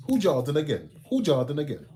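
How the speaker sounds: high, loud mock shout, falling away; shouted catchphrase imitation. Fan quoting a favourite old video, loud imitated shout. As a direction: holler it like a quoted catchphrase.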